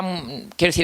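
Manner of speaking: normal talking voice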